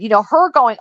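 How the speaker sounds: ordinary talk, nothing marked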